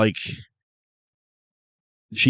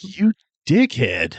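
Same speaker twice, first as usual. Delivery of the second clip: mock outraged insult; 9 semitones higher, slower than usual. Co-host's reaction to rude character.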